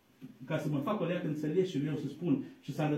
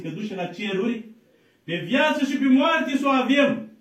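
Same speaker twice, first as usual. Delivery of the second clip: loud, higher exhortation, every phrase pushed hard; full-force preaching; 5 semitones higher, 9 dB louder than usual. Acted. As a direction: thunder the call to stand firm in faith.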